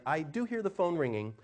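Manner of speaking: ordinary delivery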